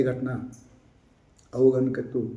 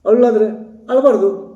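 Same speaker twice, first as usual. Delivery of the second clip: contrary opinion; 6 semitones higher, 6 dB louder than usual. Retold or imitated. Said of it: "ಮತ್ತೊಬ್ರು".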